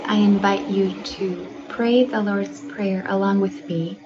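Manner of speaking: usual speaking voice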